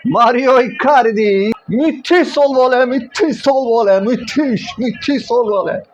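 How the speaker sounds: high, loud goal shouting; screaming football commentator. Real, not acted.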